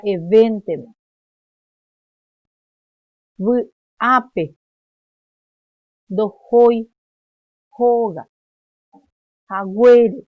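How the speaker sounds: word by word, the accented syllable punched loud; loud teacher's demo, leaning hard on each stressed vowel; isolated words with pauses, each accent heavily pushed; loaded stress. Shown as a demonstration. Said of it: "ahí le estoy cargando yo".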